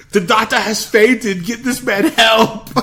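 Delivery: high, slow, loud mock-alarm; hammed-up Arnold. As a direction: announce the fainting with fake concern. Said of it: "and he goes".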